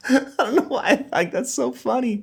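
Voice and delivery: high-pitched talk through laughter; real laughing. Real, not acted. Host cracking up at wife's silly joke, laughing. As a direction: squeeze the words out while cracking up.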